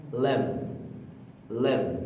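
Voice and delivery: "live" with a short relaxed i; short-vowel "live", rhyming with "give". Shown as a demonstration. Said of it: "وهنا".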